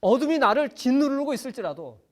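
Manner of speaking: high, loud preacher's build; rising preaching. Acted. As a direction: lift the congregation with fervour. Preaching climax. (설교 절정의 높고 힘찬 목소리)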